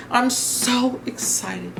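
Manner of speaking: slow gush. Real, not acted. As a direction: stretch every word out with delight.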